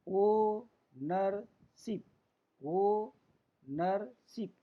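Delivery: Ownership very slowly as ओ … नर … शिप; long gaps between the syllables; teacher's drawn-out syllable demo; in three parts. Shown as a demonstration. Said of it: "तीन part में उच्चारण करने".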